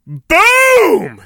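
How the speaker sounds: high shouted mock boom; explosion effect. Storybook explosion, a high shouted boom. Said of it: "That was my sound effect".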